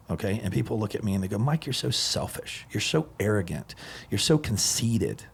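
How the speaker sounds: usual speaking voice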